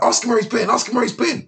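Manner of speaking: high mock Welsh voice; mimicking the Welsh boys. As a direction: pitch it way up like the pushy Welsh lads. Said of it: "They're like".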